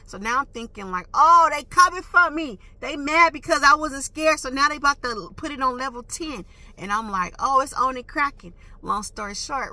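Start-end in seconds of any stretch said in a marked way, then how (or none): 1.1-6.5 loud, high, worked-up outburst; retold alarm. Driver thinking they are coming for her, loud retold alarm. 7.4-8.5 louder, higher, fired up; retold fighting talk. Driver telling herself the fight is on, retold fired-up call. Acts her readiness to fight.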